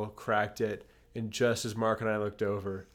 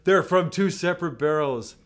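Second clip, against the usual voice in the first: mock protest; 7 semitones higher, 8 dB louder than usual. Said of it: "It's like".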